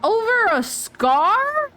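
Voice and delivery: high, drawn-out disbelief on Over; indignant at Paimon, he stretches out Over a scar, high and slow; outraged disbelief. Real, not acted.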